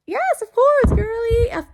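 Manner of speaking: hammed-up, delighted "yes of course girly"; gushing yes. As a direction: squeal it like an eager schoolgirl. Eagerly accepting the invite.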